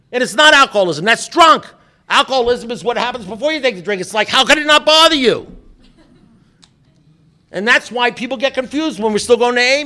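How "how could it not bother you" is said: loud, high incredulous cry; exasperated outburst. Acted. Alcoholic baffled by other people's calm, exasperated outburst. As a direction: burst out in loud disbelief.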